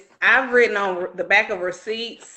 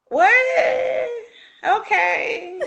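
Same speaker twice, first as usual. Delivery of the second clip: high crying shout of disbelief, laughing; shocked high cry; much slower than usual. Retold or imitated. Retelling a friend's story, a high shocked cry of disbelief. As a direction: act stunned and half-laughing at what happened. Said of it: "I was like".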